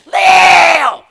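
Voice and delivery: high, ringing shout; shouted answer. Acted. Preacher finishing the verse for the congregation, shouted. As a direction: fling the word out to the church.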